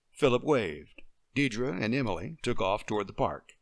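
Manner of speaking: usual speaking voice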